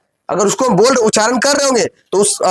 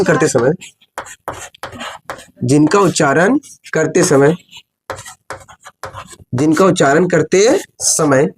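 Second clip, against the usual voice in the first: low, slow dictation with long pauses; classroom dictation; 6 semitones lower, slower than usual. Real, not acted. Teacher dictating the definition for students to write, slow dictation. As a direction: dictate phrase by phrase, measured and lower.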